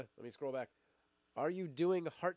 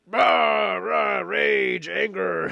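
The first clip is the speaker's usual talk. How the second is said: rage shout; 5 semitones higher, 16 dB louder, much slower than usual. Retold or imitated. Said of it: "I would wake up and be like".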